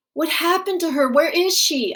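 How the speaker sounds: put-on high, upset child questions; pleading child. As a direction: beg for an answer.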